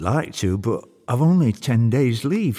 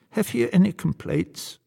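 high-pitched devil voice, politely enquiring; solicitous Old Nick; 8 semitones higher than usual. Acted. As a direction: ask as a little old devil host, pitched up.

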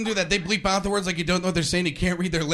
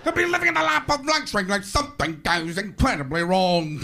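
Robin Leach; 6 semitones higher than usual. Retold or imitated. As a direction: ham it up like a gossip host. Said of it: "Robin Leach isn't laughing".

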